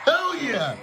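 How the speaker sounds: high, gleeful whoop; cheering. Real, not acted. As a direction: cheer like the race is won. Cheering Trump's victory.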